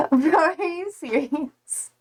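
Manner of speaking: high-pitched, laughing through the words; real laughter breaking into the question; laughing disbelief. Real, not acted. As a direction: crack up in disbelief, stretching it out. Laughing at a viewer's football comment.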